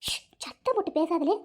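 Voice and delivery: mother hushing her son so the guests don't hear; hushed warning. Acted. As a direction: shush him so the guests won't hear.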